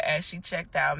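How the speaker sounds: ordinary talk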